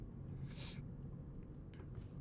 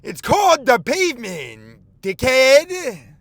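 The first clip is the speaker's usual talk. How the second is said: slower, louder and higher mocking yell; angry yelling driver; 10 semitones higher, 14 dB louder, slower than usual. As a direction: drag out each word like road rage. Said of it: "He says".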